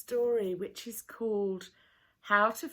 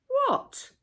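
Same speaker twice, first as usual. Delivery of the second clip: much higher, loud startled yelp; startled what; 9 dB louder than usual. A narrator's reading. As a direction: caught in the act, snap back. Quarrel over food shares.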